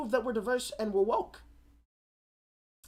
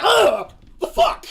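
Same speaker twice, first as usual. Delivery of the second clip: disgusted yell; 14 semitones higher, 15 dB louder, slower than usual. Retold or imitated. Disgusted reaction to bite.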